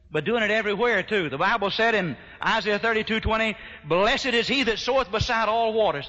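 ringing gospel-preacher delivery; full-force preaching. Real, not acted. Preaches the text.

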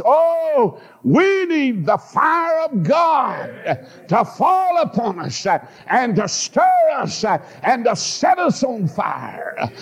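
high, slow shouted plea for the fire of God; full-force preaching. Real, not acted. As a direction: cry it out to the congregation, drawn out and high.

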